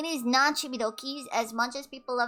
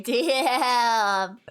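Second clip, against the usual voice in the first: ordinary delivery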